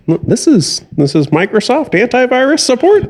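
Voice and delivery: put-on very high, querulous old-person voice. Old person explains the purchase to store staff, high old voice. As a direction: naive elderly voice rising into a question. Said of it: "this is my old person impression".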